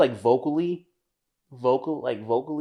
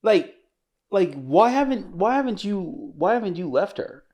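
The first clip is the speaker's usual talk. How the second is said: disbelief; 8 semitones higher, 6 dB louder than usual. Real, not acted.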